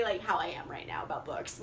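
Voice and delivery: breathy whispered aside. Real, not acted. Vlogger confiding her book excitement to viewers, whispered. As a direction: drop to a whisper, sharing a secret. Trailing aside about books.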